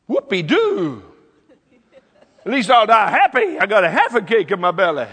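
loud sarcastic cheer; hammed-up hollow rejoicing; mock celebration. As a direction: cheer sarcastically about a sorry plan. Mocks the widow's meager plan.

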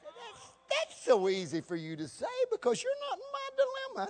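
very high, loud mock outburst; imitated indignant protest. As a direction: act out an upset woman's squealed objection. Acts upset woman protesting advice.

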